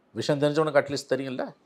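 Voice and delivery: usual speaking voice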